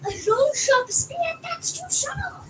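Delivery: shocked at the scary spear; alarmed surprise. Real, not acted. Child reacting to the spear in the story, alarmed.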